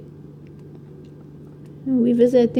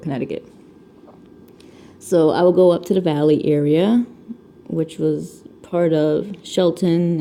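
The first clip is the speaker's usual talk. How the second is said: ordinary talk, nothing marked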